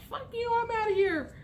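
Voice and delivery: high, hammed-up huffy exit line; mock storm-off. Storming out of a fight, high mock-huffy.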